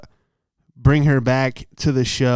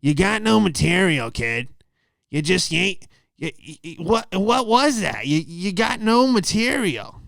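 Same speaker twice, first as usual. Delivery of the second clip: mocking the judge, pitched up and slowed at the end; gruff comedy club judge; 6 semitones higher, slower than usual. Judge dismissing the young comic, mock gruff voice. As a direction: spit out the verdict like a jaded old booker. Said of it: "He goes".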